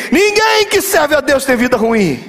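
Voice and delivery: slow, high preacher's proclamation; proclamação enfática. Real, not acted. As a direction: stretch the first word high, then drive it home. Pregador refuta o medo. (proclamação enfática, lenta e aguda)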